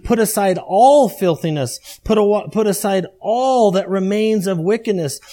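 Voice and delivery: emphatic reading, ALL hit hard. Acted. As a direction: stretch out and punch the word ALL. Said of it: "Notice it's very emphatic".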